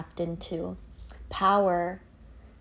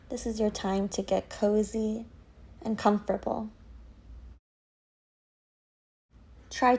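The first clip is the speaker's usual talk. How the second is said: guided meditation. Acted. Yoga teacher leading listeners through a dark tunnel visualization, guided meditation. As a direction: guide them gently inward, leaving space after each phrase.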